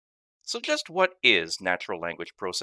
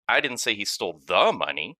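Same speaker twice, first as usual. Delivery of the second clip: "the" punched louder, the rest even; stress on the; 4 dB louder than usual. Shown as a demonstration. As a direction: hit "the" as if it were the only one. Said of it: "based on which word carries the emphasis".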